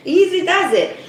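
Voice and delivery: bright teasing repeat of the phrase; playful sing-song. Shown as a demonstration. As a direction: sing it to calm yourself down. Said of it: "So easy does it".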